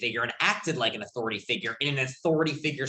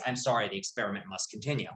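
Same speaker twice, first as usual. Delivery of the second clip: lower, softer official refusal; impassive experimenter; 5 semitones lower, 7 dB quieter than usual. Retold or imitated. Lecturer quotes the lab coat experimenter to a doubter, mildly impassive. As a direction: lightly voice an unmoved authority figure.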